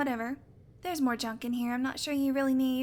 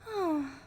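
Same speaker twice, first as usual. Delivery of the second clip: resigned sigh; 4 semitones higher than usual. Acted. Giving in to the hunter's point, sighing. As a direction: let out a tired breath. Resigned before getting to work.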